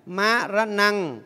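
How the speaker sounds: Ma ra năng đọc rất chậm, tách từng âm; xướng Ma ra năng thật chậm cho đại chúng đọc theo; đọc mẫu chậm. Shown as a demonstration. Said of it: "đọc theo thầy". (đọc mẫu chậm rãi Ma ra năng)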